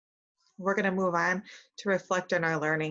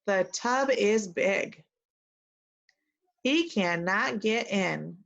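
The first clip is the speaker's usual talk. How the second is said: put-on slow, word by word read for kids to copy; slow model reading; slower than usual. Shown as a demonstration. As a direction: model each word slowly so they can repeat. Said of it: "repeat after me".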